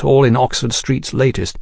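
ordinary delivery, nothing marked

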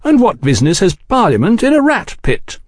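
mildly inquiring gentleman; mild inquiry. A narrator's reading. Oliphant questioning the men's claim, mildly. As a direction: ask it lightly, pitch lifted then falling. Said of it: "Oliphant inquired mildly".

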